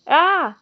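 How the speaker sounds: sudden loud high ouch of pain; pained yelp. A narrator's reading. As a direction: yelp as the dragon bites. Hagrid bitten on the leg.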